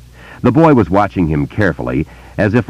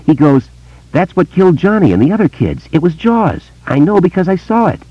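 put-on higher, eager boy's voice; young boy; 12 semitones higher than usual. A narrator's reading. Boy telling Bill about voices, in a higher boy's voice. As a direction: voice a wary small boy telling a secret.

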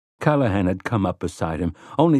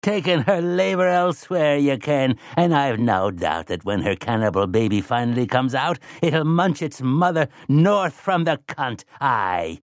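put-on taunting like an old witch; 4 semitones higher than usual.

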